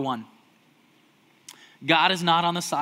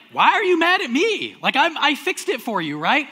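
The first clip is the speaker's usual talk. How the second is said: baffled husband; 9 semitones higher, 7 dB louder than usual. Retold or imitated. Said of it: "you're like".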